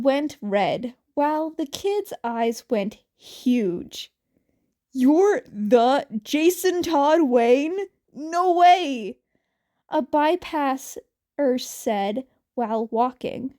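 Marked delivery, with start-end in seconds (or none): 4.9-9.2 louder, amazed fan gasp; can't believe who it is; starstruck. Acted. Bypasser recognizing Jason Todd Wayne, loud and amazed.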